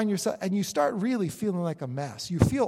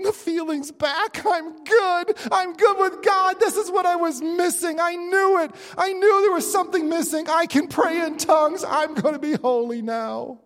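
much higher and louder, bursting with joy; ecstatic elation; 8 semitones higher, 5 dB louder than usual. Acted. Believer after tongues, telling himself he's good with God, ecstatic. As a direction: gush like you just got filled with the Spirit.

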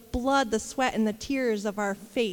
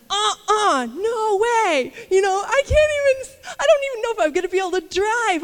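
high, loud, tearful protest; flesh throwing a tantrum; 8 semitones higher, 6 dB louder than usual. Retold or imitated. Mocking her flesh to the church, high loud tearful protest. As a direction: let the flesh wail that it won't go. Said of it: "And my flesh was going".

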